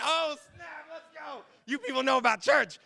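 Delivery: loud, high burst of excitement; big mock-thrilled whoop; playful hype. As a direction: cheer it like a crowd-pleasing reveal. Mock hype cheer announcing Advent.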